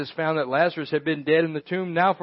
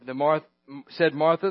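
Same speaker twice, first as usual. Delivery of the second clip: usual speaking voice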